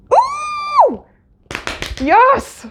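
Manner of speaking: high delighted whoop, loud; real excited cheer. Real, not acted. Reacting to Matt's comeback, thrilled loud whoop.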